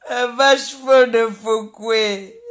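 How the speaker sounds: loud and slow, every word hammered out; drawn-out mocking yell; slow loud taunt. Real, not acted. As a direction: bellow the insult slowly, word by word. Taunting a rival on live.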